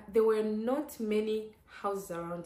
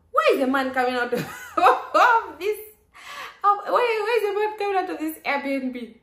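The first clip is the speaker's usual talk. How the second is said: shocked; 10 semitones higher, 9 dB louder than usual. Retold or imitated. Shocked to see a man.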